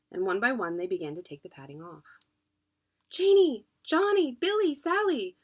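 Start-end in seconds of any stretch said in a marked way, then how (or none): 3.1-5.4 high, loud calls of names; calling out. Acted. Parents calling for hidden children, loud calls. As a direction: call the lost children by name. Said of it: "grandfathers called".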